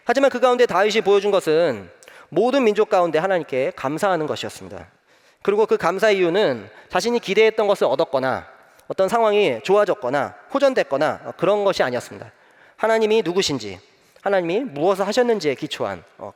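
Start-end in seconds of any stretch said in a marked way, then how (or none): none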